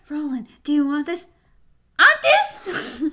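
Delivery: hammed-up whisper shout, laughing; whisper yelling. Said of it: "It's like".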